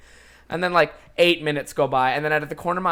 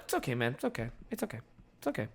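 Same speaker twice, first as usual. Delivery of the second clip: soft reassuring; 4 semitones lower, 13 dB quieter, much faster than usual. Retold or imitated. Friend reassuring despite being mad.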